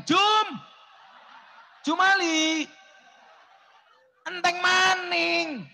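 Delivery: high, loud call across the house; shouting for servant. Acted. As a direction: bellow for Jumali to refill.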